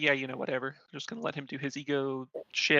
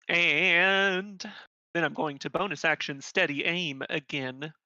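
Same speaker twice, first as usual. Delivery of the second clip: ordinary delivery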